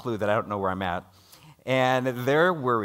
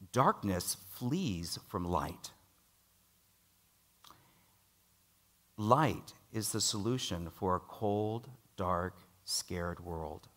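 slow, softer sermon emphasis; solemn preaching; 3 semitones lower, 6 dB quieter, slower than usual. Acted. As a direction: drag out each word to weigh the congregation down.

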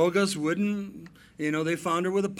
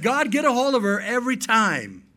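loud prayer; 5 semitones higher, 5 dB louder than usual. Retold or imitated. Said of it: "we said".

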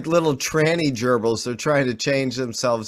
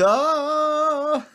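mock cry; 13 semitones higher than usual. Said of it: "the guy goes".

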